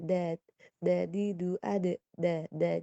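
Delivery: "da" "di" "du" with a firm Arabic dal; stable dal demo. Shown as a demonstration. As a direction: model the dal syllables crisply. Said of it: "dalnya masih distabilkan". (contoh dal yang mantap)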